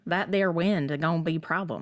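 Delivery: hammed-up Southern twang; terrible Southern accent. Mimicking the aunt on hurricane wind, mock Southern accent. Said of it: "that was a terrible Southern accent".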